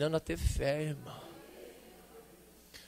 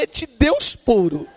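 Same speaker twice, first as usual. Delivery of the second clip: amazed; 8 semitones higher, 9 dB louder, slower than usual. Retold or imitated. His amazed reply, retold.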